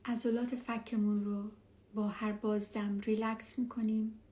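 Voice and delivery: ordinary talk, nothing marked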